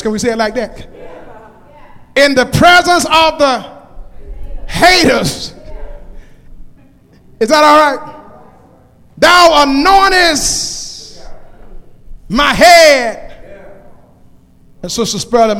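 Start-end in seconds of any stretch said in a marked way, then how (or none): 2.2-13.3 raised, ringing scripture declaration; full-force preaching. Acted. Preacher declaiming the psalm to the congregation, full-force preaching. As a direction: proclaim it loud, phrase by phrase.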